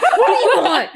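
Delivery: play-acting a hormonal outburst, half laughing; mock angry snap. Imitating snapping at boyfriend Steve, laughing through it. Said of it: "it just gets so mad".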